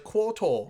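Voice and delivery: "Quartal" with the R sounds lost; childhood R problem. Retold or imitated. Player recalls childhood speech, "Quartal" with no R sound. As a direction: imitate a child unable to say R in "Quartal". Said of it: "couldn't pronounce an R sound".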